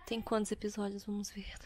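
whispered aside to herself; breathy hushed check. Real, not acted. Checking episode count, whispered aside.

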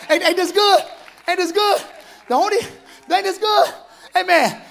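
rising excited ask to the crowd. Real, not acted. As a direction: beam it out to the pews. Excited call to congregation.

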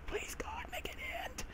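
breathless whispered prayer; hushed desperate plea. Real, not acted. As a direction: beg God under your breath.

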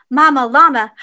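usual speaking voice

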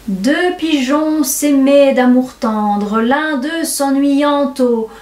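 slow, grandiloquent verse with stretched vowels; récitation théâtrale. Acted. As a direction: declaim it like an old-school tragedienne. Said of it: "je fis ma révérence et je commençai". (déclamation lente et grandiloquente)

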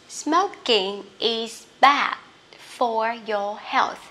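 slow reading, D of BAD left unreleased; glided final consonant. Shown as a demonstration. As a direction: ease off the end of BAD before FOR. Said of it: "cách để mình đọc lướt". (đọc chậm, lướt âm D cuối của BAD)

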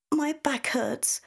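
put-on high, slow, tearful child voice; a small girl whimpering about her sore back; tearful little girl. A narrator's reading. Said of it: "says Isla tearfully".

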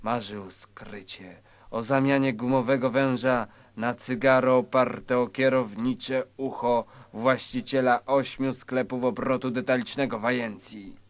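normal talking voice

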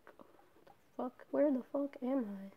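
hushed, bewildered aside; retold stunned muttering. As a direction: say it softly to yourself, lost. Retold shocked aside about the hospital.

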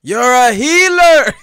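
high, loud, drawn-out call; hammed-up game shout; mock game cry. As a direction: cry it out like a gamer in need. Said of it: "Just remind me of all them damn games".

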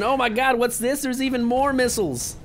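high-pitched, hammed-up disbelief; gasp-free awe, pitched well up; mock amazement.

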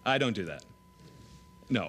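ordinary talk

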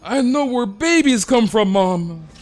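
put-on high, loud excited child voice; hammed-up kid calling his mom; kid yelling at mom. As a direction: shout it like a kid who found a secret. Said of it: "He's like".

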